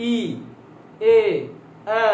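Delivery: ই, এ, এ said alone with pauses as the tongue lowers; isolated Bengali vowels ই এ এ; vowel demo. Shown as a demonstration. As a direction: sound ই then এ then এ slowly, one by one. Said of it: "আমি আবার বলছি".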